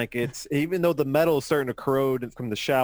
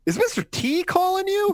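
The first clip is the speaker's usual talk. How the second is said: much higher, mocking squeak; squeaky buddy voice. Buddy teasing his friend in a squeaky voice. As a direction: pitch way up like a startled kid. Buddy's line in comic read.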